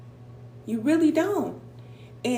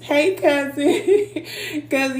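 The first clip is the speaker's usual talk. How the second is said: laughing greeting; 4 semitones higher, 4 dB louder, slower than usual. Real, not acted. Laughing aside to a viewer.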